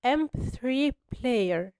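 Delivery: slow, careful model of إم بي ثري player; slow demonstration. Shown as a demonstration. Teacher showing students how the word begins, slowly. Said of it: "نطقت الكلمة ازاي".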